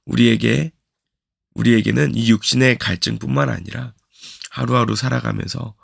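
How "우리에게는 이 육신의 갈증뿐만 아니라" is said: ordinary talk, nothing marked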